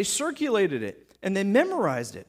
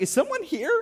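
curious guest; 9 semitones higher than usual. Retold or imitated. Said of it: "I'm like".

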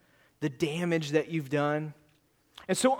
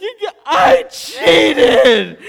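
high, drawn-out, hammed-up wailing shout; comic retold bawling scream; yelled confession; 10 semitones higher, 13 dB louder, much slower than usual. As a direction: blurt out the guilt in a mock-tearful yell. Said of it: "And I just yelled".

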